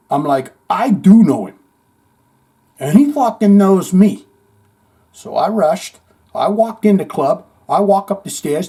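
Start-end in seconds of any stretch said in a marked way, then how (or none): 0.7-4.3 insistent retort. Retold or imitated. Insisting to mocking buddies.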